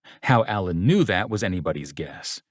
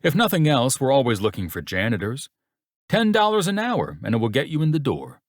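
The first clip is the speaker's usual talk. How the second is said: pitched-up, patronizing sales pitch; mock-helpful condescension; 6 semitones higher than usual. A narrator's reading. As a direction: offer it as a thinly veiled insult. Boss taunts suitor with janitor job.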